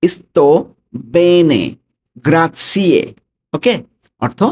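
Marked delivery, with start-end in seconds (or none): 0.0-3.0 clear, emphatic teacher's model; careful pronunciation. Shown as a demonstration. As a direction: announce "Sto bene, grazie" word by word for learners to copy. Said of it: "উচ্চারণটা আমরা আবার খেয়াল করি".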